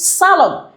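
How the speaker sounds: "salon" stressed on SA; "salon" with a strong SA, falling away on LON; stress on first syllable. Shown as a demonstration. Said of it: "receives the stress on the first syllable".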